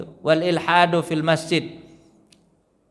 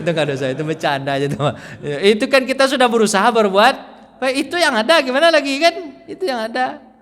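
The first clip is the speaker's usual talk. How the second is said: defensive excuse; 8 semitones higher, 3 dB louder, faster than usual. Retold or imitated. Voicing the son's excuse.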